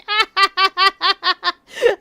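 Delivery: high, loud cackling laugh; loud laughing fit. Real, not acted. Streamer cracks up at own failed voice, loud high laughter. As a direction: burst out laughing, loud and long.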